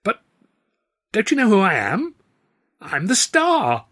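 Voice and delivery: high, loud, affronted disbelief; bewildered. A narrator's reading. Star to a booing audience, bewildered. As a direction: play it loud and high, baffled and put out. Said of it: "his bewildered expression clearly said".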